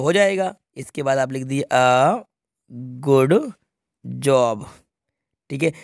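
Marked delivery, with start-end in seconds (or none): 1.7-4.6 "a ... good ... job" with long pauses between words; word-by-word dictation. Shown as a demonstration. ("a", "good", "job" रुक-रुक कर लिखवाते हुए)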